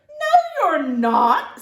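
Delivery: big sister refusing her little brother; Sophie snapping. Acted. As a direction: shut him down hard. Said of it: "said Sophie".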